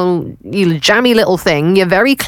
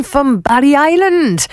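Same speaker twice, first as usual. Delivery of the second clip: bad Welsh accent; 8 semitones higher than usual. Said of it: "that was a terrible Welsh accent".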